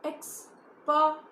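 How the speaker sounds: "experiment" said slowly, each syllable apart; syllables spoken out. Shown as a demonstration. Teacher to children, slowly sounding out "experiment". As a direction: stretch out "experiment" so every jaw drop shows. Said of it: "see, experiment".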